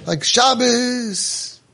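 high, drawn-out mock yell; mock screaming. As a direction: stretch each word out in a high scolding cry. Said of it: "screaming at him".

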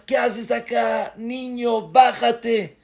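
guards scolding. Acted. Guards scold boy on carriage.

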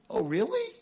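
jauh lebih tinggi, kaget dan tak percaya; surprised girl. Retold or imitated. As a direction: sound like a stunned teenage girl. Girl doubting the teacher.

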